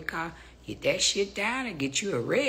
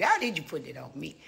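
ordinary talk, nothing marked

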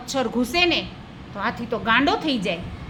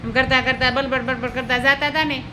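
usual speaking voice

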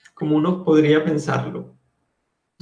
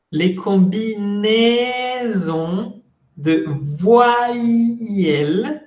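stretched-out French syllables, pitched higher; very slow modelling; 4 semitones higher, much slower than usual. Shown as a demonstration. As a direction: draw every vowel out slowly for learners. Teacher repeats it very slowly.

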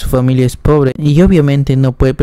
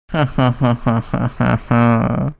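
carcajada fingida en golpes repetidos; risa actuada; 4 dB quieter than usual. Acted. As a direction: suelta una risa teatral, a sílabas sueltas. Risa imitada de personaje del relato. (risa fingida de personaje)

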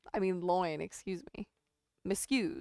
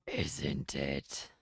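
much lower voiced take; low growl punchline; 6 dB quieter than usual. Retelling the dinosaur joke, deep dinosaur voice. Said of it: "dinosaur punchline retry".

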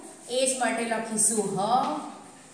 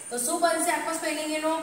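ordinary talk, nothing marked

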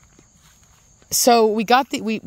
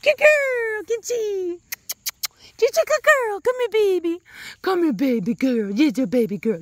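much higher cooing pet voice; 11 semitones higher than usual. Real, not acted. Cooing at her dog.

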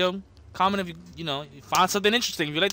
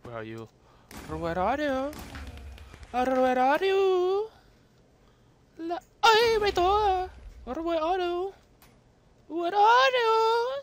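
slow, high, drawn-out calling; sing-song taunt; 9 semitones higher, much slower than usual. Pretend. Seeking the hiding player, slow high sing-song taunt. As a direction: croon it like a stalker hunting prey. Searching for the enemy in game.

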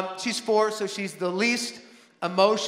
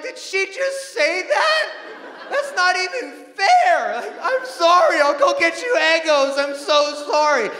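mock anguished apology; 7 semitones higher, 4 dB louder than usual. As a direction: beg forgiveness in mock despair. Dad pleading with crying daughter.

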